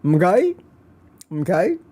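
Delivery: Mister Mackey "mkay". Retold or imitated. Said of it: "That's Mister Mackey".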